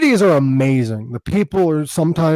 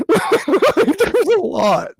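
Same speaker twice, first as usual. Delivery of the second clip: laughing; much slower than usual. Real, not acted. Laughing at own joke.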